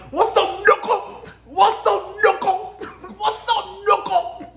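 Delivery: high, loud put-on Pinky voice with a laugh; Pinky impression. Imitating a character's catchphrase for her viewers, in a high, loud voice. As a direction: ham up Pinky's greeting, drawn out. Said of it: "Pinky from The X-Files".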